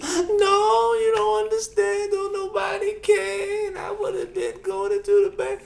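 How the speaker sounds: high, slow, wailing mother protest; crying old mom. Retold or imitated. Mother still crying, insists to son in a high wail. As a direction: sob out the protest like an elderly mom. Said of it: "starts crying".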